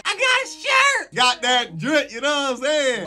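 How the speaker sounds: high, slow, thrilled yell; excited shout. Real, not acted. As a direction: yell it out in delight. Unboxing merch, shouting.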